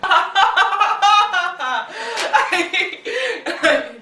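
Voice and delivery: laughing. Real, not acted. Laughter after tape.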